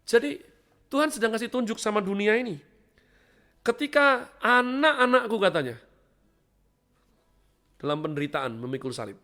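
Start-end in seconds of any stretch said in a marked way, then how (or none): 0.0-5.4 high, loud, fervent preaching; full-force preaching. Acted. Preacher calling out to his flock, fervent and loud. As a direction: drive it home to the congregation.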